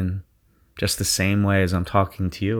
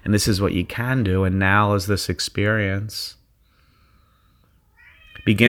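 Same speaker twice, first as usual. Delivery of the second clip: guided meditation. Acted. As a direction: ease them into their zone. Leads a guided visualization.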